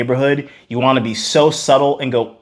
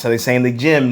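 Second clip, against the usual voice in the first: ordinary delivery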